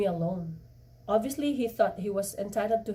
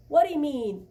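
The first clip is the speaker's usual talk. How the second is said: loud, higher, sharp demand; 6 semitones higher, 6 dB louder than usual. Acted. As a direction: fire the question at him. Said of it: "Pug demanded".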